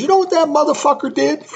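high-pitched quote broken by laughter; laughing through quote. Retold or imitated. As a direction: quote the outraged artist while cracking up. Retelling the artist's outrage, laughing.

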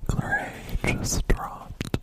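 slow ASMR whisper; breathy whisper into the mic. Real, not acted. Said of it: "Stop A S M R ing".

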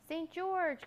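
high, loud call for a rescuer. Acted. Upside-down knight calling for Saint George, high loud call. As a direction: call the name out high and long. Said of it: "called the poor upside-down Turkish knight".

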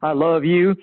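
lightly voiced big mountain man; mildly booming, hearty declaration. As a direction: boom it out a little, imitating a big man. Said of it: "I hear this big booming voice".

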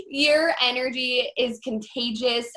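ordinary talk, nothing marked

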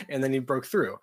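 ordinary delivery

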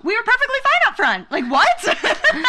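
rising exasperated laughing protest; calm turning angry. Retold or imitated. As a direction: start polite then blow up. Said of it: "we're like".

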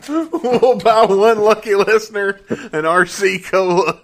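high, loud, slow promo announcer. Pretend. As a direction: sell it like a radio promo. Mock listener contest promo.

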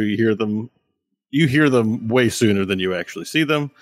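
ordinary delivery

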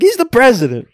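high, loud indignant retort; mock outraged dad. Dad defending the president, high and indignant. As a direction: snap it back, scandalized. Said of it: "he's like".

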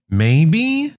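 put-on rising, questioning kid voice; tentative, with a big upward lilt; unsure pupil. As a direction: let it climb into a doubtful question. Pupil unsure of the obvious answer.

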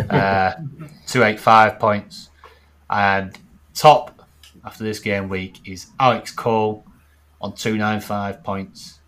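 loud announcer readout of standings; dreary voice. Acted. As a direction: read the table out like a results show. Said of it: "they've got my dreary voice to read it out".

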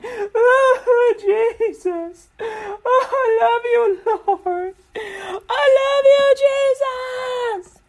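high, loud, hammed-up crying to Jesus; mock sobbing prayer. Praying person cries love to Jesus, mock tearful wail. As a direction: wail it out like an overcome worshipper.

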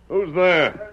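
loud, high challenge into the dark; calling out. Acted. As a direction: call out sharply at an unseen stranger. Challenging a stranger at night.

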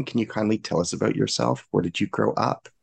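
ordinary talk, nothing marked

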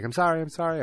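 high, loud panicked apology; frantic apology. Retold or imitated. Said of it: "she's like".

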